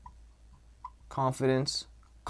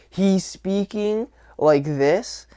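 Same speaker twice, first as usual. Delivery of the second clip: higher, slow nasal imitation; nasally; 6 semitones higher, much slower than usual. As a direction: stretch it out through the nose. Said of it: "it's kind of nasally".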